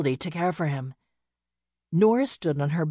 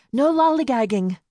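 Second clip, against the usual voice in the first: loud, high prodding of a horse; urging; 7 semitones higher, 6 dB louder than usual. A narrator's reading. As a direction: call out to hurry the horse along. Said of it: "she urged the horse".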